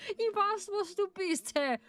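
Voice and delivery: high-pitched, playful German cursing; mock swearing. Streamer cursing at a dense chatter in German, mock exasperation. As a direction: rant in German, laughter breaking in. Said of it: "Yeah, this is swearing".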